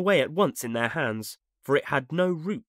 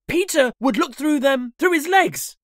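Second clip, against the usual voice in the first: high, loud, urgent answer; boys' cry; 13 semitones higher, 8 dB louder than usual. A narrator's reading. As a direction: shout the idea as excited boys. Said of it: "Almost in the same breath they cried".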